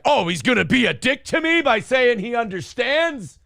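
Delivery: high-pitched mock outrage; indignant woman imitation. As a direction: mimic an offended woman working herself up. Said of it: "But women are like".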